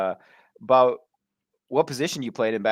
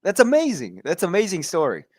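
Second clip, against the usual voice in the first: much higher, louder that's amazing; delighted amazement; 9 semitones higher, 5 dB louder than usual. Real, not acted. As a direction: gush with real delight. Listener delighted reaction.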